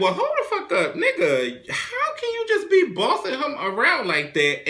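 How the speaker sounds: squeaky, high-pitched disbelief with laughter; incredulous outburst. Retold or imitated. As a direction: squeal it, half laughing, in mock outrage. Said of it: "And just, and I'm like".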